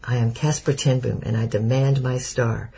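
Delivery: plain reading voice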